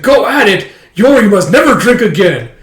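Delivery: high, loud mock horror; hammed-up shocked shout; appalled outburst. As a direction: yell it as a scandalized brother. Brother appalled, waiter asks lower voice.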